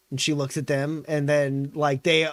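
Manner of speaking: usual speaking voice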